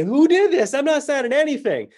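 high, mock-indignant complaint; imagined annoyed passenger complaining about the cards. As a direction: huff it like an offended passenger. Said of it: "ring their call bell and say".

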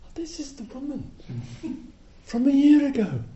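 high-pitched sudden recognition. Retold or imitated. Teacher recalling the visitor, high surprised. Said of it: "and I remembered".

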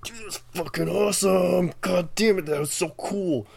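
stoked bro voice, loud and high; hyped studio dude. Retold or imitated. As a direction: gush like a stoked bro in the booth. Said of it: "there's some dude like".

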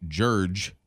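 "Jurge" with an r put into judge; grandfather's pronunciation. Retold or imitated. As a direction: say "Jurge" to rhyme with urge. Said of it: "as opposed to judge".

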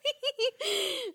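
high, loud, slowed by laughing; dissolving into laughter. Real, not acted. Co-host explaining the lyric to a colleague, laughing hard. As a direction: let the sentence collapse into laughter. Cracking up at own interpretation.

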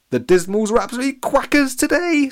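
laughing. A narrator's reading. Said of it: "laughing as Gina sulked off".

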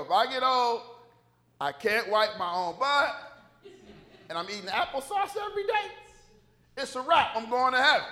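loud, high comic proclamation; mock defiant declaration. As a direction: announce it big, for laughs. Playful declaration about getting old.

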